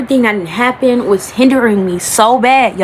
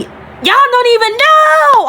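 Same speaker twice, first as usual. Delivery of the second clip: much higher, drawn-out cry to viewers; emotional outburst; 4 dB louder, slower than usual. Real, not acted. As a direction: burst out, overwhelmed, slowly.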